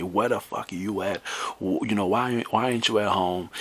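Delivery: ordinary talk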